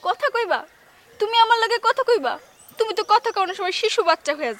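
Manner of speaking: high, loud, scornful questioning; scornful challenge. Acted. Woman confronting an unwanted suitor, scornful challenge. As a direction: throw it back at him in disbelief.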